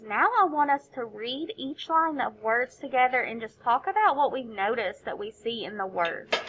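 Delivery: ordinary talk, nothing marked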